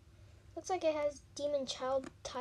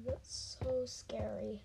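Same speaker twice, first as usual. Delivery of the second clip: scared whisper; 4 semitones lower, 7 dB quieter, slower than usual. Real, not acted. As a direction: whisper "that's so scary" under the breath. Whispered in fright.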